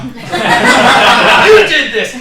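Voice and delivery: mock furious at heaven. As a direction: shake a fist at the sky. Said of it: "shake his fist at heaven".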